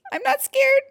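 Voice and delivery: tiny squeak, very high and loud; frightened squeak. A narrator's reading. Jim denies fear in the dark, squeaking. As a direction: squeak it out at the top of the voice. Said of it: "squeaked Jim".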